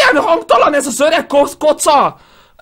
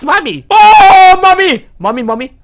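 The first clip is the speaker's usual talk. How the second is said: ordinary delivery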